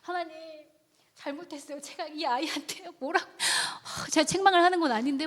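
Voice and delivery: hushed, high-pitched remorseful plea; desperate prayer. Retold or imitated. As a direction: confess to God in a small, pleading voice. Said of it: "쥐어 붙잡고 막 기도하는 거예요".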